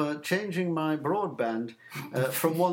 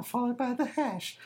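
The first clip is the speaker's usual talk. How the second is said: higher, prim recorded-message cadence; automated phone voice; 7 semitones higher, 4 dB quieter than usual. Retold or imitated. Caller mocking the answerphone prompt, higher and prim.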